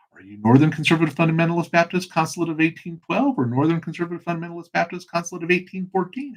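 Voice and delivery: comic rush through an absurd name; rapid-fire question. Acted. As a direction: rattle off the long title fast. Rattles off absurd denomination name.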